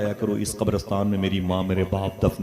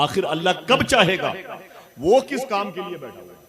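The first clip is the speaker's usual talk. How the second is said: mocking angry wife; 10 semitones higher than usual. Wife mocks her husband's pir for never praying, scornfully. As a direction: sneer at the pir's empty promise.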